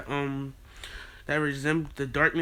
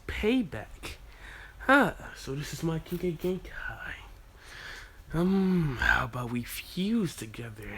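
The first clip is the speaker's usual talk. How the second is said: hushed scheming; 3 dB quieter than usual. Acted. The good Naruto whispering as he watches the payback.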